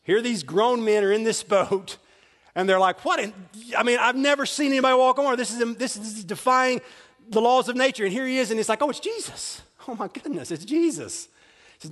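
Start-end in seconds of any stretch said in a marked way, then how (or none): 3.0-8.0 astonished. Acted. Disciples astonished at man on water. 8.8-11.2 high, hushed mock amazement; amazed relief. Disciples realize it is Jesus, amazed and relieved.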